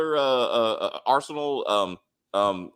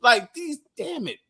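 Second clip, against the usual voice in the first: incredulous; 10 semitones higher, 5 dB louder than usual. Real, not acted. Protesting character's change of job.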